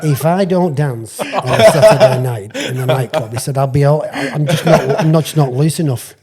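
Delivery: can barely get the words out for laughing; laughing through it. Real, not acted. Laughing while quoting player.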